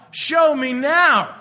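very loud, high, drawn-out demand; theatrical, exasperated shout; climactic demand. Acted. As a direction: belt out each word slowly and forcefully.

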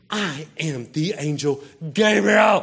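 slow, loud proclamation rising to a shout; hammed-up angelic declaration; indignant angel. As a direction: announce yourself with booming indignation. Angel announces himself to doubter.

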